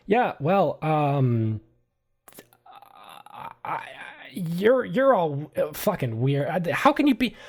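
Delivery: high, stumbling, agitated outburst; flustered exasperation. Acted. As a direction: break off and urgently rush everyone out. Anderson flustered, hurrying everyone away.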